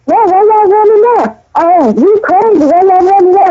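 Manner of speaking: a gleeful mock jeer, pitched high; mocking taunt. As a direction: tease the fleeing crowd. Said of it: "y'all was like".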